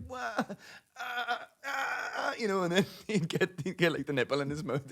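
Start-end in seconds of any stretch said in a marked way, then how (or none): 0.1-2.3 high, whiny imitated baby crying; mock baby wail. Dad imitates a friend's hungry baby crying, mock wail. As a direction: squeal like a fussing newborn. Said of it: "he'd be like".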